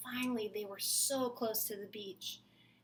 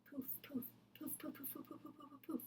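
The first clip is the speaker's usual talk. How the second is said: soft poofs; 5 semitones higher, 11 dB quieter than usual. A narrator's reading. Magic vanishing sounds.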